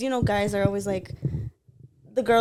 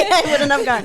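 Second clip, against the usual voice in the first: louder, higher laughing reply; 8 semitones higher, 11 dB louder than usual. Real, not acted. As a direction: laugh it off, defending yourself. Laughing defence of invitation.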